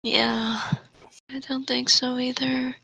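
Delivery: usual speaking voice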